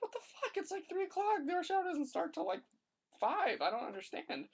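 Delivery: high-pitched incredulous rant about the traffic; a retold high, disbelieving outburst; exasperated disbelief. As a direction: squeal it out like you can't believe this. Stuck in baffling traffic.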